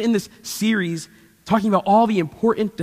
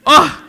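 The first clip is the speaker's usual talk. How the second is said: mock groan; 8 semitones higher, 11 dB louder than usual. Groan about nap ending.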